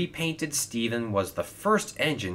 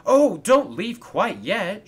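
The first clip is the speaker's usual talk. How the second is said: loud, high, stretched-out plea; eager shout; 11 semitones higher, 10 dB louder, slower than usual. Acted. Lonely Coldy begs new friends to stay, shouting eagerly. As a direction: call them back eagerly. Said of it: "shouted Coldy eagerly".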